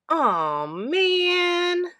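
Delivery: a hint of a sulky fox, rising on man; disappointed fox. A narrator's reading. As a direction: groan it like a thwarted thief. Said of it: "said Swiper".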